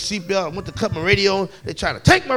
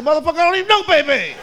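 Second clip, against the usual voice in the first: high-pitched exasperated protest; indignant retort; 6 semitones higher, 4 dB louder than usual. Acted. Indignant retort in acted argument.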